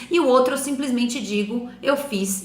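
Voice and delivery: ordinary talk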